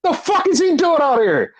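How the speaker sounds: high-pitched mock bafflement; squeaky-high retold disbelief; Seth Rollins. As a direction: imitate an utterly thrown reaction, voice way up. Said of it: "He's like".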